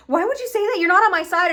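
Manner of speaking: high, loud mock indignation; offended. Retelling Charlie turning on Vaggie, hammed-up offended protest. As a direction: protest it high, acting wounded. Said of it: "Charlie gets all offended".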